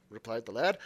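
ordinary delivery

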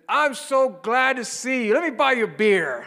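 high, very loud, stretched-out holler; boisterous greeting. Retold or imitated. Pub patron offering the priest a drink, boisterous shouting. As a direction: bellow a delighted welcome across the pub.

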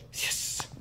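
unvoiced little cheer; quiet victory yes; whispered yes. Real, not acted. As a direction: hiss a triumphant yes under the breath. Quiet celebration after sorting PayPal.

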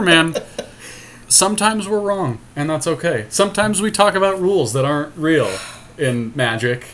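normal talking voice

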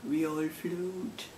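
slow, hushed, eerie clown voice; slow, soft creepy clown line read off a badge; creepy Pennywise. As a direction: drag it out like a sinister clown. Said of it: "mine says".

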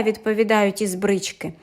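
usual speaking voice

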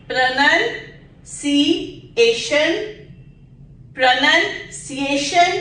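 "pronunciation" slow with clear sh; slow model. Shown as a demonstration. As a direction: repeat it slowly for the class. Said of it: "इसको श या इसको स नहीं करना".

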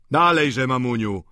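loud, jolly shout; hearty call. A narrator's reading. Vautrin hugging landlady, jolly loud. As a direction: sweep her up cheerily.

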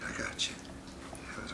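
soft reassuring whisper. Real, not acted. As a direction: hush it to a pet. Soothing pet softly.

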